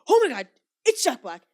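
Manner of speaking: loud, high starstruck fan impression; exaggerated fanboy yelling; mock freaking out. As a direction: imitate a fan losing it over an idol. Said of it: "he's freaking out".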